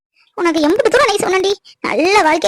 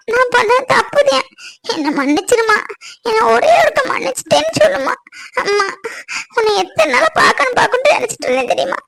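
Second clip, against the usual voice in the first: tearful, cracking pleading cry; crying plea; 5 semitones higher than usual. Acted. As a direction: beg through tears. Daughter begging forgiveness. (அழுதுகொண்டே மன்னிப்பு கேட்பது)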